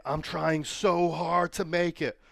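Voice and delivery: hammed-up slow whining; whiny self-pity. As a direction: drag it out like a self-pitying post. Mocking social media posters.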